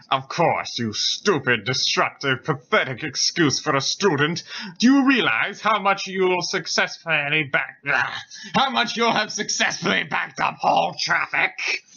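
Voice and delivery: mildly irate professor, rising in pitch; angry scolding. Acted. Professor telling off an out-of-line student, angry. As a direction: scold the student, building in indignation. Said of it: "glared murderously at the out-of-line student".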